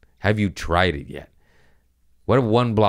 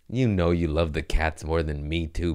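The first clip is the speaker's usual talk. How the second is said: ordinary talk, nothing marked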